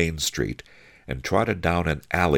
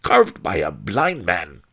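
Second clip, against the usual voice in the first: raised, scornful character voice; sneering; 4 semitones higher, 4 dB louder than usual. A narrator's reading. One-Eyed Wong belittling the carving, sneering. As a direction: pour scorn on the workmanship. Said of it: "sneered One-Eyed Wong".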